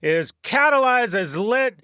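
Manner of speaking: trailer-voice grandeur over history's big moments; epic announcer. Acted. Host opening his podcast with mock-epic announcer voice.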